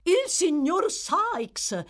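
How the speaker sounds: high, loud, drawn-out welcome; gushing, stretched-out cry of joy; joyful exclamation. A narrator's reading. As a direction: greet him with showy delight. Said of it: "con una gioiosità autentica o simulata".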